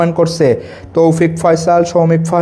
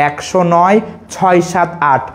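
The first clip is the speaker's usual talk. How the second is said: slow একশো নয় ছয় সাত আট with pause after নয়; teacher models একশো নয় ছয় সাত আট slowly; chunked number recital. Shown as a demonstration. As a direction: mnemonic chant, slow. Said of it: "সবাই এভাবে উচ্চারণ করো আমার সাথে".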